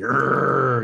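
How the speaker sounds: loud mock-angry growl. Shown as a demonstration. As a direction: growl it out as a show of anger. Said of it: "if you're angry, you're gonna be".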